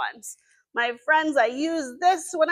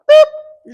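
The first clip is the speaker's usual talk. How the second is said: playful boop; 14 semitones higher, 15 dB louder than usual. Acted. Drawing letter in air.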